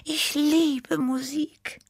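slow, very high, dreamy cat voice; sighing Tippi. A narrator's reading. Small cat confesses love of music, sighing. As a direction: draw it out high with longing. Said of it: "seufzte Tippi".

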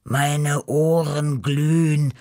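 slow, drawn-out groan of an overheated cat; long weary groan; groaning Beppo. A narrator's reading. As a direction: moan it slowly, suffering from the heat. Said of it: "stöhnte Beppo".

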